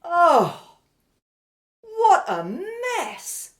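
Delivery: loud, high wail of dismay; appalled, drawn-out high cry; exasperated dismay. Acted. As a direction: groan it out high, then draw out the words. Dismayed at a mess.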